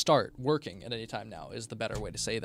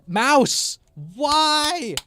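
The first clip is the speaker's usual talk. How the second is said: frustrated yell; 13 semitones higher, 10 dB louder than usual. Real, not acted. Yelling at a broken mouse.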